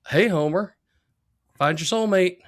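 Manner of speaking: lightly voiced talking dog, a hint of Skinner; a touch of Principal Skinner in the dog's greeting. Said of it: "the voice is like Skinner almost".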